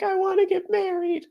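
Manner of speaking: very high thrilled squeal; giddy girl voice. Acted. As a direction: squeal back, overjoyed. Lena squeals yes.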